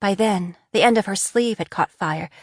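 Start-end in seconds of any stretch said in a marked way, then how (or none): none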